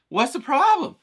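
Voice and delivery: jumps up high, alarmed question; surprised. Retold or imitated. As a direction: ask it startled and loud. Startled reply in retold chat.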